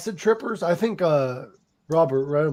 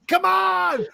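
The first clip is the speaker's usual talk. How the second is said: rock frontman shout; 10 semitones higher than usual. Pretend. Mock rock frontman calling crowd.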